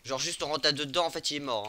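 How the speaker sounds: loud heated gamer callout; raging gamer. Real, not acted. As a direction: shout it out mid-fight. Heated fight commentary.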